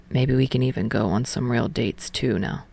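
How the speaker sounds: quiet, low, almost shy suggestion; low tender Tsukishima. Acted.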